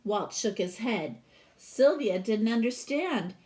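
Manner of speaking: lively read-aloud storytelling voice; storybook reading. Acted. Teacher reading a picture book to her class, expressive read-aloud.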